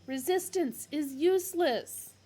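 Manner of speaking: loud mechanical shout; guard shouting. Acted. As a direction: shout it by rote. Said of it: "the guard shouting again".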